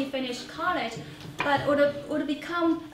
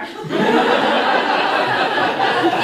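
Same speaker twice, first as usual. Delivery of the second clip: airy burst of laughter, higher and louder; breathy laugh; 8 semitones higher, 11 dB louder than usual. Real, not acted. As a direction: laugh out loud at the absurdity. Laughs at the kidnapping idea.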